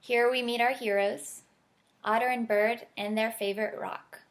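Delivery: gentle storybook read-aloud; reading to children. Acted. Author reading her picture book to listeners, gentle storybook voice. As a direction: read it like bedtime story time.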